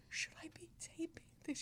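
whispered aside. Retold or imitated. A whispered aside to Joni's colleague. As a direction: whisper it so nobody else hears. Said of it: "and I went".

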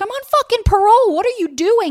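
high-pitched panicked protest, retold; imitated freak-out. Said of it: "like, freaking out".

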